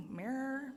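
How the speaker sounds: mirror said "mer-uh"; "mirror" with open e vowel; mirror, other way. Shown as a demonstration. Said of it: "however you say".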